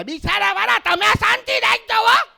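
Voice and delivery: very high, loud mock outburst; hammed-up scolding shriek; shrieking wife. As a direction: shout him down at the top of your pitch. Wife yells at her meek husband.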